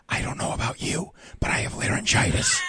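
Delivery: breathy whisper, no voice. Retold or imitated. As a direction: whisper the punchline. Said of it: "she's whispering".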